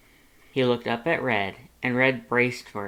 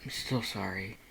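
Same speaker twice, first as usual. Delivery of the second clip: much softer, lower, contrite; quiet apology; 3 semitones lower, 9 dB quieter than usual. A narrator's reading. Red apologizing again to Lust, soft and contrite. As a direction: let it barely come out.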